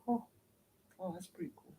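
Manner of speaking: hushed little realization; mock sudden calm. People after achieving it, hushed mock surprise. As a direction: drop very soft, as if suddenly pleased after all the fuss.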